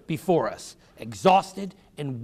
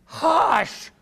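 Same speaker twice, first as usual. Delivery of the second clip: loud, high hush; children shushing an interrupting brother; shouted hush; 13 semitones higher, 5 dB louder than usual. A narrator's reading. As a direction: hush him sharply and loud. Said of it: "the children shouted".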